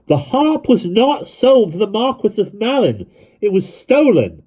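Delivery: high, loud and slow, full lungs; bawling at full pitch. A narrator's reading. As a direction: bellow it slowly over the crowd. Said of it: "He bawled out at the full pitch of his lungs".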